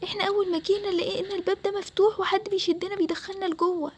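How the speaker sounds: desperate prisoners begging to be saved; trapped captives crying for help. Acted. Captive explains their trap. (أصوات محبوسين بتستنجد بيأس)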